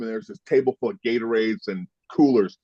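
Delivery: ordinary talk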